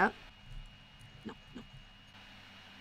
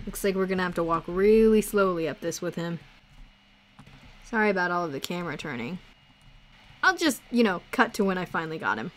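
ordinary talk, nothing marked

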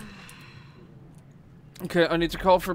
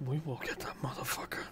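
unvoiced conspiratorial whisper; 4 semitones lower, 17 dB quieter than usual. Real, not acted. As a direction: hiss the vow secretly. Same vow, whispered.

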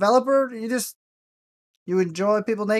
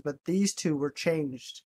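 normal talking voice

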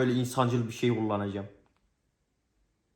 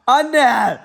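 loud call to mom; shouting; 12 semitones higher, 16 dB louder than usual. Real, not acted. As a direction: yell it out.

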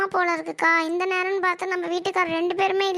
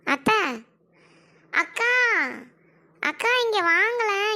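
calling out; 3 semitones higher, slower than usual. Acted. Daughter-in-law calls her sister-in-law over, drawn-out call. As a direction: stretch the call so she hears you.

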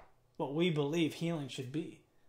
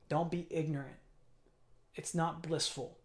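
ordinary talk, nothing marked

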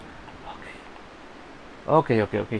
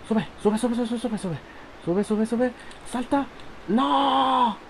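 high, tense pleading; frantic urging; 10 semitones higher, much slower than usual. Real, not acted. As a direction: urge it on in a panic. Urging the character, then failing.

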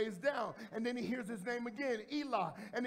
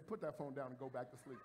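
mock pastor's reply; 7 semitones lower, 7 dB quieter than usual. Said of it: "you should hear me say".

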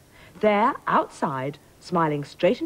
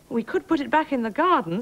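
higher, hopeful suggestion; 8 semitones higher than usual. A narrator's reading. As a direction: offer it hopefully, pitched up. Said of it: "said the queen hopefully".

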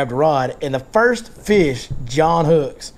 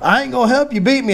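high, quick mock refusal; imitated protest; 9 semitones higher, faster than usual. Buddy refusing to help his rival win, mock protest. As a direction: mimic a buddy balking in a higher voice. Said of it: "And he goes".

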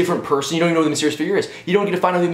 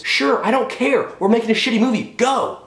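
imitated exasperated director; 8 semitones higher than usual. Director to actress asking about her voice, mock exasperation. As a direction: hurry the actor along, past caring. Acted director brushing off Emily Blunt.

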